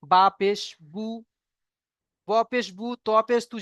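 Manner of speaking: teacher's spelling chant; reciting the primer. Shown as a demonstration. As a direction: drill each syllable for the class. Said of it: "কেমন?".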